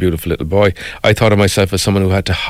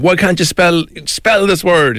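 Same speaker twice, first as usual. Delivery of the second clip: higher-pitched imitation of an impatient father; raised-pitch, scolding retold demand; mock shouting father; 7 semitones higher, slower than usual. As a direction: imitate dad shouting, without much volume. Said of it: "Now you're shouting at me".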